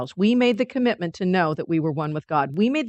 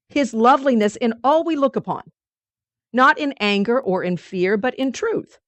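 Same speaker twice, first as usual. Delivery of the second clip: ordinary talk, nothing marked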